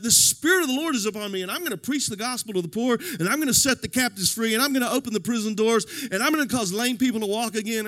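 fast, loud, swaggering proclamation; with an attitude. Retold or imitated. Not meek and mild Jesus, fast cocky proclamation. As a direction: preach it like he owns the room. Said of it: "he's got a little bit of an attitude here".

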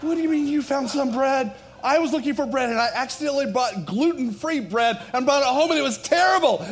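high, loud comic outburst; mock exasperation. Pastor protesting to Jack over bread, mock exasperation. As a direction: complain in hammed-up outrage, building to the last word.

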